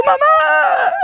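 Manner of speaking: very high wailing scream; terrified worm cry. Acted. Little worm screams for mother before the toad, high wail. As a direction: shriek for mum in hammed-up panic.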